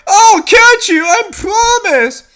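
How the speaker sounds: high, loud reassuring call; urgent promise. Acted. Rescuer below shouts a promise to catch her.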